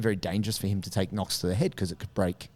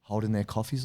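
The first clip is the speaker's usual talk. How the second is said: ordinary delivery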